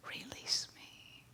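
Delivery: soft slow whispered plea; whispering fish. Acted. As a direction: breathe the plea like a dying fish. Fish pleads a third time.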